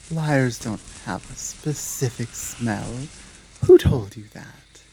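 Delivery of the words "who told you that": sharp demand. Acted. Werewolf challenges the intruder's claim.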